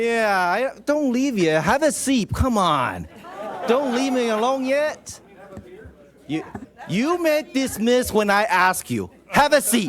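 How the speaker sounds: high, playful begging tone; playful pleading. Acted. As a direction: coax them to stay, teasing. Mayor keeps guests from leaving.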